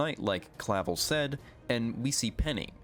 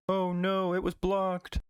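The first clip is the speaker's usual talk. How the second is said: higher, hammed-up cartoon whine; mock dismay; 9 semitones higher, slower than usual. Pokemon whining that its attack got blocked, higher mock dismay. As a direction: whine like a defeated cartoon monster.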